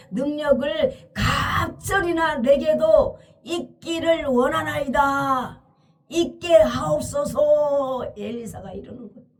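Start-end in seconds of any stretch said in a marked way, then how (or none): 0.1-8.1 slow, drawn-out pleading; earnest plea. Acted. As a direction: plead fervently, stretching the key words and endings. 엘리사의 간구를 연기함. (길게 늘여 간절히 간구하듯)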